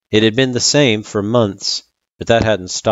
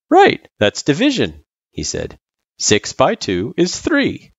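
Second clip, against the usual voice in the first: higher, lightly voiced tutor; Sans, approving tutor; 8 semitones higher, 3 dB louder than usual. Sans confirming Frisk's answer, approving. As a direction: confirm it encouragingly.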